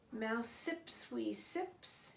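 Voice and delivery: usual speaking voice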